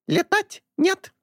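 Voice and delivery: high slow squawking bird. A narrator's reading. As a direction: squawk it like a parrot. Bird denies. (птичий крик)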